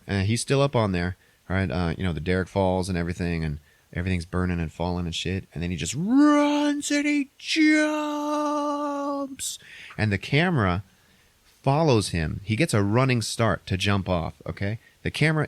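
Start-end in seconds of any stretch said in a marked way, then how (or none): 6.0-9.6 high, slow, stretched-out exclamation; hammed-up falsetto slow motion; dramatic climax. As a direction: draw the words out through the leap. Storyteller stretches the climactic leap.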